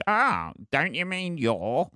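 high, slow, smug correction; smug pedant voice. Retold or imitated. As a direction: drawl it like a know-it-all. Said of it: "everybody goes".

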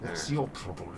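menacing words through shut teeth; clenched teeth. Pretend. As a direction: jaw locked, sounding ready to fight. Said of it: "You sound angry all the time".

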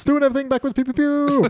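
high-pitched goofy voice; playful silliness. Pretend. Podcaster clowns about doing things backwards, playful silliness. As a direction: toss it out high like toy laser noises.

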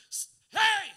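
put-on sharp hey to kids. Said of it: "you're like".

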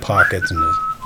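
ordinary delivery

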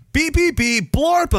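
high chirpy electronic beeps; mock robot beeps. Reprogramming the migrants with beeps and boops. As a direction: bleep like a silly machine. Mimics boops and bops therapy.

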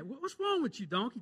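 a prophet snapping at his balking donkey; angry outburst. Retold or imitated. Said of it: "And Balaam gets mad".

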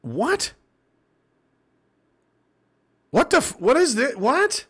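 high, baffled questions; puzzled disbelief at the screen; high confused what. Real, not acted. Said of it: "Okay, I'm really confused".

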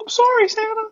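high squeaky elf voice; timid apologizing elf; christmas elf. Pretend. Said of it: "and you're the elf".

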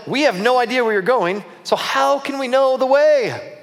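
high-pitched, pleading complaint; acted exasperation, slowing at the end; exasperated protest. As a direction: voice up high, drawing out the last question. Thomas protests to Jesus.

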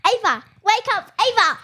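loud, high wake-up call; shouting her name to rouse her. Acted. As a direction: yell her awake. Waking sleeping friend.

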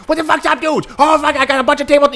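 high, rowdy jobsite bro voice; hyped-up morning dude. Acted. Comedian acting a dude raring to go at six a.m., hyped and high. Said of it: "there's, like, the dude who's, like, at six o'clock in the morning".

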